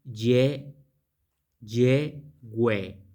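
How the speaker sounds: "ye, yehue" as YEH, then YEH-WEH; pronunciation demo. Shown as a demonstration. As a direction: sound out "ye, yehue" in separate, emphatic syllables. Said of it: "y es la pronunciación".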